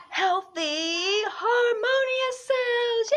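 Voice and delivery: high, slow airy voice; dreamy answer. Pretend. Answering viewers with a dreamy reveal. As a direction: draw it out dreamily.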